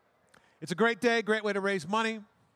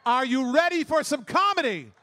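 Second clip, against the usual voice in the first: rally the room, louder; crowd hype shout; 4 dB louder than usual. Real, not acted. Emcee firing up the crowd before the comics, shouted. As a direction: shout it to the back row, pumped.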